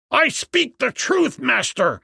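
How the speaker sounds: high, pleading insistence in panic; frightened priest. A narrator's reading. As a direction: insist shrilly that it is true, terrified. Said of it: "A frightened priest".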